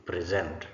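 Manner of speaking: preSENT, weight on the last syllable; stress on second syllable. Shown as a demonstration. Teacher models the verb for the class, second-syllable stress on "present". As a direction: say "present" as the verb, hitting "sent". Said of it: "as a verb".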